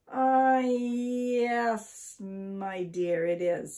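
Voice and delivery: long, loud, high "Ah", settling down; hesitant husband playing for time; stalling for thought. A narrator's reading. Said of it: "thinking that it certainly must be".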